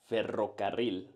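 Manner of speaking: Ferrocarril with strong trilled double R; rolled R. Shown as a demonstration. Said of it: "this is how you should do it".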